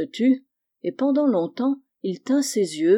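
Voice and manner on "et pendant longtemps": usual speaking voice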